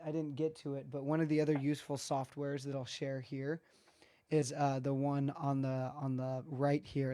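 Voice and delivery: ordinary delivery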